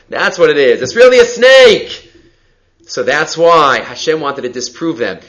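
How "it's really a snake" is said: higher, louder, slower cry of discovery; sudden realization. Retold or imitated. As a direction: land it like a eureka moment. Sorcerers realize, acted quote.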